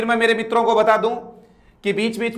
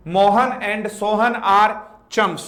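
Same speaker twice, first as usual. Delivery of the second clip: slow dictation; much slower than usual. Shown as a demonstration. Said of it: "teacher dictates the example sentence".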